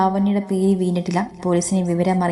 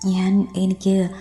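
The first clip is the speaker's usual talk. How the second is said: choked up; slower than usual. A narrator's reading. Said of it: "ചുണ്ടുകൾ വിതുമ്പിക്കൊണ്ടിരുന്നു".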